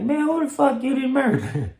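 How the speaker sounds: very high, shocked mock scold; imitating the mama. The mama seeing the bloody shirt, very high. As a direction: shriek it like a shocked mom.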